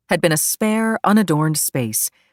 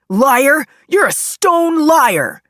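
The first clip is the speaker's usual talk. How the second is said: high, loud accusation, drawn out at the end; angry shout; 10 semitones higher, 7 dB louder, much slower than usual. Acted. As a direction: shout it out as an outraged courtroom outburst. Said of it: "shouted out".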